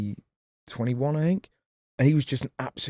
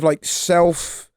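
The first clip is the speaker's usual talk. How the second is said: normal talking voice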